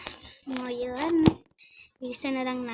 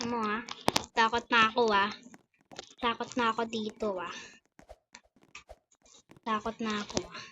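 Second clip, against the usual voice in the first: ordinary talk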